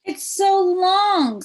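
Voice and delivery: high, slow mocking kid voice; teasing classmate. Joe teasing Chrysanthemum about her name, high and mocking. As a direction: stretch it out to tease. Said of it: "said Joe".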